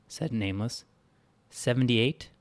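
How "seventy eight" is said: ordinary delivery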